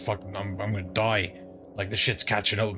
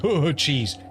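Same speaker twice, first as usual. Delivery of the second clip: "cheese" said higher and louder, knowingly; a knowing nudge on "Oh, cheese"; slyly in on the joke; 5 semitones higher, 4 dB louder than usual. Shown as a demonstration. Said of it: "but you think".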